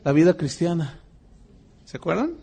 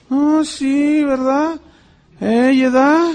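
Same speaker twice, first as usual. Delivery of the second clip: higher, sneering gossip voice; mocking gossiping brethren; 6 semitones higher than usual. Missionary imitating envious church members, mocking sneer. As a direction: mimic the jealous church members.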